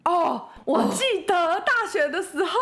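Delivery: slow and high, laughing. Real, not acted. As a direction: stretch the line out while laughing. Recalling, laughing.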